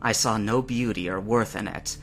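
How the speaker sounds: ordinary delivery, nothing marked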